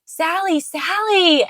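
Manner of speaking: high, lilting call; sing-song name call. Shown as a demonstration. Parent close in the child's space, high playful call. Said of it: "get in their space".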